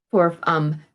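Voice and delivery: ordinary delivery, nothing marked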